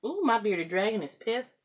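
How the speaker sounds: ordinary talk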